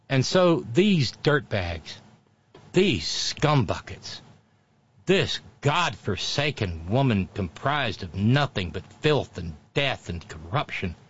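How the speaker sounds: slow, emphatic contempt; scornful denunciation. Real, not acted. Host denouncing a woman who spoiled a beautiful moment, slow scorn. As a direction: drag out each insult with disgust.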